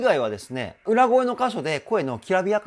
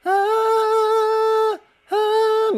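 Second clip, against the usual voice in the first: very high, slow, thin falsetto note; weak falsetto; 7 dB louder, much slower than usual. Shown as a demonstration. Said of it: "弱くなってしまう".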